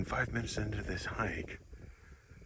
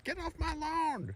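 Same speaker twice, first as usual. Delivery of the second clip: put-on very high, drawn-out old man yell; high-pitched cartoonish old-man holler; grumpy old man. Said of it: "equivalent of the old man going".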